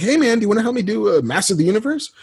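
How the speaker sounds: high, quick friend voice; Kevin impression. Friend asking for help on a show, imitated high. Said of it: "The way you do his".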